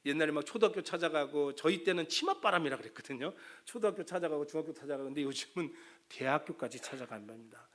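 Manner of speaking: ordinary delivery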